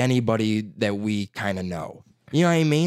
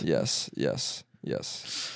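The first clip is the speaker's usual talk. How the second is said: fading to a whisper; 11 semitones lower, 9 dB quieter, much slower than usual. Real, not acted. Eager agreement fading.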